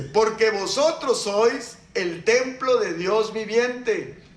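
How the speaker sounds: slow, deliberate reading of "Porque vosotros sois el templo de Dios viviente"; slow scripture reading. Shown as a demonstration. Pastor reads the verse slowly to his congregation. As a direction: lay each word of the verse down slowly.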